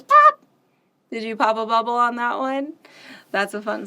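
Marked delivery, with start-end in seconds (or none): 0.0-0.4 high, loud pop; shouted pop. Acted. Teacher shouting the pop at the song's end. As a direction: burst it out on the last word.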